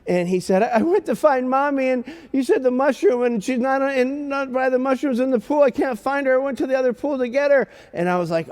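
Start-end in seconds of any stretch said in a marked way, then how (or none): 0.6-7.7 put-on much higher child voice, running on without a break; crying little boy. Lost son explaining to dad in his arms, imitated tearful child voice. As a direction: imitate an upset small son explaining himself. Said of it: "and he's crying".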